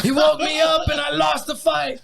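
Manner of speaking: high loud mocking shout; mock whiny neighbor. Joking with friends about the beaten neighbor, mock shout. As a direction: ham up the sore loser.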